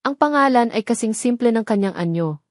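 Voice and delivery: ordinary delivery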